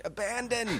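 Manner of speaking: high, theatrical acting-coach call; drama teacher. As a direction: lift it up like a grand drama teacher. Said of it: "you sound like an acting coach".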